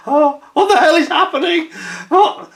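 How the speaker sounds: high, loud mock-panicked bride; imitated bewildered bride. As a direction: shriek it in disbelief. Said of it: "my bride-to-be was like".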